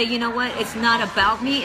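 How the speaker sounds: ordinary delivery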